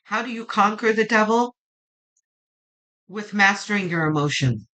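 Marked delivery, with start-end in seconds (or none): none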